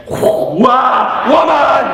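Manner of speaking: high, loud hammed-up yell; lustful shout. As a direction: bellow it like a man overcome with lust. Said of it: "he'd be like".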